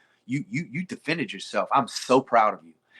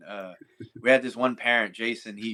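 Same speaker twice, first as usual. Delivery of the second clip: ordinary delivery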